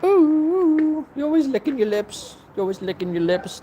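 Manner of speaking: very high coo to a pet. Real, not acted. Owner cooing at lip-licking dog, high baby talk.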